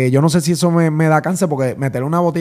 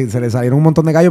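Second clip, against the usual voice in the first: ordinary delivery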